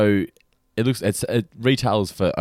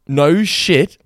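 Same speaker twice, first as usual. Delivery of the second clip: louder, higher retold shock; shocked exclamation; 5 semitones higher, 6 dB louder than usual. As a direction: blurt it out like the whole room froze. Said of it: "Everyone was like".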